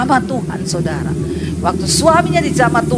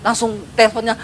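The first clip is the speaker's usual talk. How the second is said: usual speaking voice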